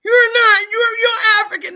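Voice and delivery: high, loud Karen imitation; hammed-up Karen voice, high and loud; mock Karen. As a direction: shriek it like an outraged Karen. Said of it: "being a Karen".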